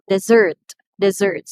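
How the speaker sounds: "dessert" twice, each with a long, loud SSERT; longer second syllable. Shown as a demonstration. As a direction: stretch and punch the last syllable of "dessert". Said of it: "is pronounced longer than the first syllable".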